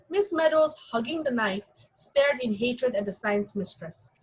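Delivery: usual speaking voice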